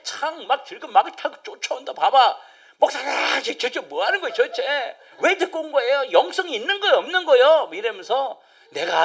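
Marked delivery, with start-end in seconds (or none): 1.9-7.7 higher, louder accusing voice; mock angry complaint; complaining congregant. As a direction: play an upset member confronting the pastor. Congregation voiced scolding pastor.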